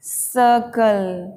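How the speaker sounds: सर्कल slowly as suhh-kul; silent r. Shown as a demonstration. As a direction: stretch the first vowel of सर्कल, no r. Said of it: "आर silent हो जाता है".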